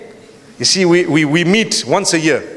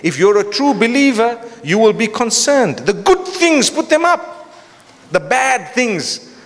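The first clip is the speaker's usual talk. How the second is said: high-pitched, emphatic sermon delivery; rousing exhortation; impassioned preaching; 7 semitones higher than usual. Real, not acted. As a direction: drive the warning home, pitched up. Preaches with force.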